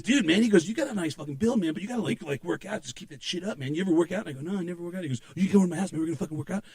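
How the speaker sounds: Pablo Francisco impression. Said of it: "I'm doing a Pablo Francisco now".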